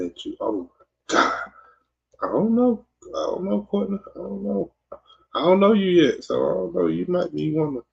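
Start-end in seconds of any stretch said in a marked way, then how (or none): 2.2-7.8 high, sing-song mock innocence. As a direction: play dumb in a falsetto. Mock high voice answering a reading-out of chat.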